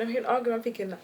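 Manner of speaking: ordinary talk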